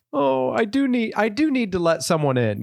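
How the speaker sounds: Miranda's realization. Retold or imitated. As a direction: play Miranda giving in at last. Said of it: "And she'd be like".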